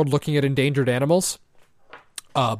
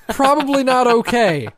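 higher, louder laughing reply; 7 semitones higher, 4 dB louder than usual. Real, not acted. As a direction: laugh through the words. Laughing at a bad joke.

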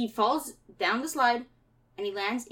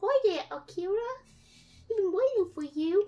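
put-on high little-girl greeting from a hidden figure; sweetly eerie child, pitched up; voice from the armchair; 6 semitones higher than usual. Hidden Sandy greeting Akira from the armchair, high child voice. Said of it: "This is a voice coming from the armchair".

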